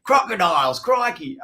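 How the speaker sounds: hammed-up TV Aussie, high and loud; mock Aussie exclamation; broad Aussie accent. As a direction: shout it like a croc hunter. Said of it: "on T V when you see an Australian".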